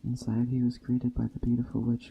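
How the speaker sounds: ordinary delivery, nothing marked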